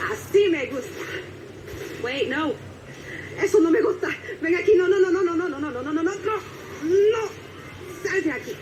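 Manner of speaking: high Spanish voice as the character Shelly, laughing through it; high playful Spanish voice with bursts of no no no; Shelly impression. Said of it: "Can I do a Shelly impression?".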